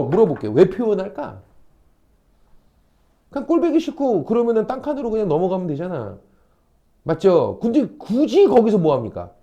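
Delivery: normal talking voice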